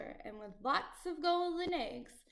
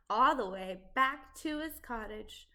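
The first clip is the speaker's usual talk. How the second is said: usual speaking voice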